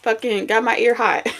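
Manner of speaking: usual speaking voice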